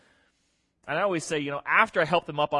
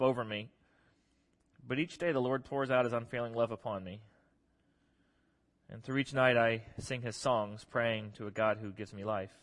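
soft, lower, solemn scripture reading; psalm reading; 3 semitones lower, 4 dB quieter than usual. A narrator's reading. Preacher reading the psalmist's plea to God, soft solemn reading. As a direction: read it gently and reverently, voice sinking low.

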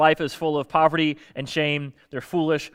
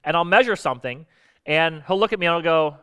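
ordinary talk

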